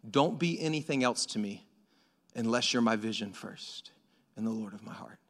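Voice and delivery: low, hushed, tender prayer; quiet reverent reading fading to a whisper; soft hymn prayer. Acted. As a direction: pray the hymn softly to God. Reads hymn softly, intimate prayer.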